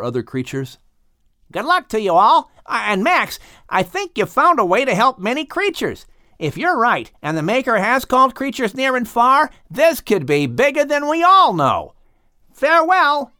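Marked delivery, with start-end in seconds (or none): 1.5-13.3 high, kindly dolphin voice; father dolphin. A narrator's reading. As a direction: voice the dolphin dad lifting high and bright. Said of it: "said the father dolphin".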